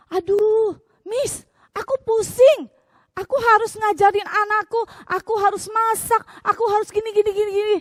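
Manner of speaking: high, loud, exasperated mom venting; stressed mom complaining. Retold or imitated. Mom complaining to the teacher, high and loud. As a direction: whine it out like an overwhelmed mother. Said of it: "banyak dengar curhatan mami-mami".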